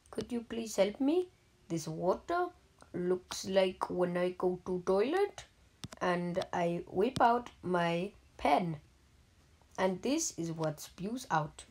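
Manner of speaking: ordinary delivery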